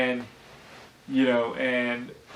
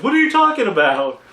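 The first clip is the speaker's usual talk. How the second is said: high, loud mock outburst; Homer, startled; 8 semitones higher, 7 dB louder, faster than usual. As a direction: toss it out like a startled cartoon dad. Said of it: "And he's like".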